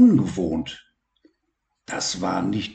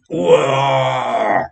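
louder playful lion's roar; 5 semitones higher, 5 dB louder than usual. Acted. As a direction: roar like a friendly lion. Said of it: "brüllte Simba". (verspieltes Löwengebrüll)

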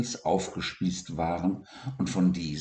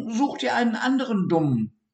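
high, fast, dismissive little rodent; snippy high dormouse brush-off; haselmaus voice; 4 dB louder, faster than usual. Acted. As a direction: brush him off in a squeaky rush. Dormouse's blunt rebuff quoted.

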